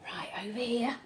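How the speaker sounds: soft unvoiced whisper. Real, not acted. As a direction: breathe it softly. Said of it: "settling child, whispering".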